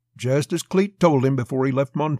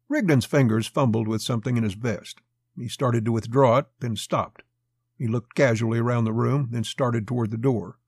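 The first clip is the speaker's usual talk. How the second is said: plain reading voice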